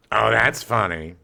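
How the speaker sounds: slow, dry sarcastic retort; deadpan it like a heckler; a slow, dry sarcastic heckle. Retold or imitated. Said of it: "sarcastic retorts of".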